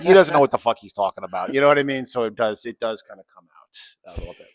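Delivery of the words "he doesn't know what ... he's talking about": loud beery Chicago griping; loud mock-tipsy Chicago accent. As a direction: gripe in a Chicago accent, amused. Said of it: "my Chicago accent comes out".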